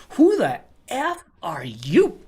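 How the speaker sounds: slow, incredulous challenge; who-do-you-think-you-are retort, acted out; indignant retort. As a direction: draw it out in mock indignation. Acting out group member's hostile response.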